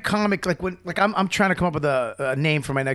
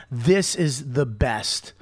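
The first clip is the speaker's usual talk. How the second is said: slow, drawn-out proud title; each word slow and sure; 3 semitones lower, much slower than usual. Pretend. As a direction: spell out the title slowly. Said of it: "I want your next album to be".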